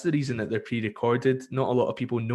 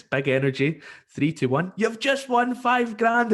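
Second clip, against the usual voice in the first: hyped announcer; 10 semitones higher, 3 dB louder than usual. Retold or imitated. Host announcing a prize, big hyped reveal. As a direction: hype up the win like a game show. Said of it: "I says".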